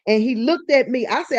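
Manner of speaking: ordinary talk, nothing marked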